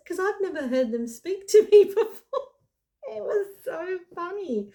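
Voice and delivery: high, loud voice breaking into laughter; laughing. Real, not acted. Storyteller cracking up at her earbuds talking, laughing. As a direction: crack up while talking, pitch shooting up. Cracks up telling the funny part.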